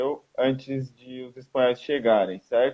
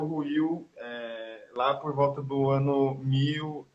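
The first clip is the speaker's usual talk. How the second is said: ordinary talk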